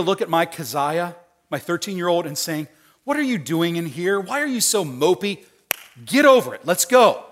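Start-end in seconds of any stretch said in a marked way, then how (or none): 3.0-7.2 exasperated scolding building to loud, high command; brusque dad, rising to a shout; impatient father. Acted. Father to his mopey thirteen-year-old daughter, impatient scolding. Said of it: "which father do you want me to be?".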